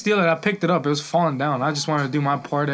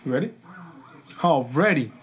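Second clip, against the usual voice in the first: ordinary delivery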